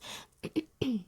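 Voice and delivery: quick throat clear. Real, not acted. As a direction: clear the throat mid whisper. I've got a tickle in the throat.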